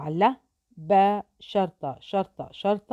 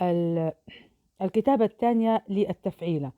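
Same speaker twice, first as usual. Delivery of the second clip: ordinary talk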